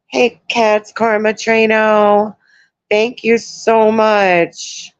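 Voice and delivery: high, slow, sing-song hello to a viewer; light up as you greet a fan by name; sweet greeting. Real, not acted.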